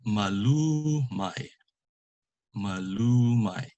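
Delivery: MALUMAY said slowly with drawn-out LU; penultimate stress. Shown as a demonstration. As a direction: lean long on LU in MALUMAY. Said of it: "accent is on the second to the last".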